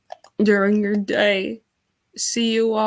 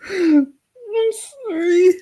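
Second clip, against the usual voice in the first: louder, higher choked apology; real crying; 6 semitones higher, 5 dB louder than usual. Real, not acted. Streamer failing to stop crying, tearful. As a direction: break into tears apologizing. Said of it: "I keep crying".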